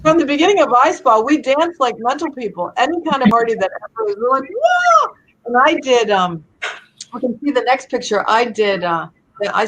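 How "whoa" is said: a huge high whoop; excited whoop. Retold or imitated. Retelling how they cheer at parties, high excited whoop. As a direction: whoop it up like at a party.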